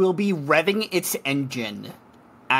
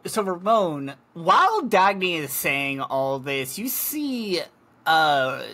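usual speaking voice

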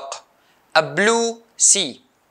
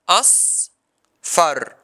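"Asfar" split into as and far, long pause between; syllable by syllable. Shown as a demonstration. Teacher showing the two syllables, syllable by syllable. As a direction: break "Asfar" into two drawn-out beats. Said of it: "This word has two syllables".

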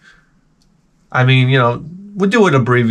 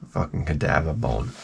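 low, soft grumble; disgusted mutter; 9 semitones lower, 10 dB quieter than usual. Real, not acted.

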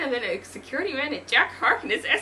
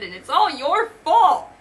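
loud, slow, high-pitched blame; accusing; 7 semitones higher, 12 dB louder than usual. Acted. Doctor Strange blaming Thor as police arrive, accusing. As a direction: drag out the accusation loudly.

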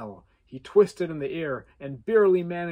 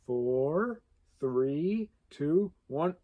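ordinary delivery

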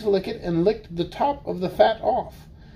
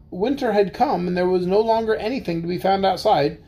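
ordinary delivery

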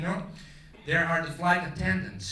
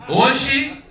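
higher, louder, slow taxi driver's patter; tourist-trap Portuguese; 6 semitones higher, 9 dB louder, much slower than usual. Retold or imitated. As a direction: con a tourist with a grin. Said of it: "In Portuguese, it's the perfect language to fool a tourist".

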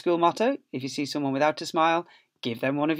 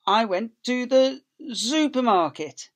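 announcing the title; 8 semitones higher, 7 dB louder, slower than usual. Acted. Title read to children, big build-up.

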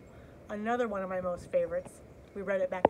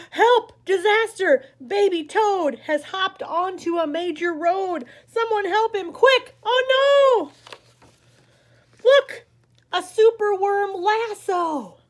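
much higher, storybook panic; mock alarm; 11 semitones higher, 12 dB louder, slower than usual. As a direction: gasp and cry out for help, playfully. Alarm over Baby Toad.

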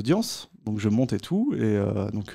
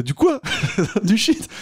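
shocked disbelief; 11 semitones higher, slower than usual. Retold or imitated. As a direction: sound floored by the answer. Cellmate's shocked retold reply. (surprise incrédule, voix haute et forte)

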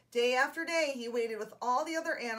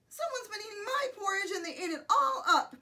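high storybook little-bear wail; crying Baby Bear; 8 semitones higher, 4 dB louder than usual. A narrator's reading. Baby Bear finds the porridge gone, mock crying. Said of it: "cried the Baby Bear".

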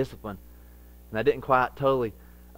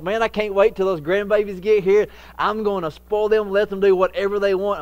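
louder, higher, gleeful grandpa; excited father-in-law; 7 semitones higher, 7 dB louder than usual. Retold or imitated. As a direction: play the eager grandpa ready to spoil. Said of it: "He's like".

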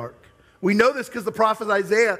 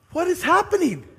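higher, stunned "what is happening"; shocked inner thought; 8 semitones higher than usual. Retold or imitated. Pastor recalling his shock at the sad movie, stunned. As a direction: replay the dawning shock of the movie. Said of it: "I thought to myself".

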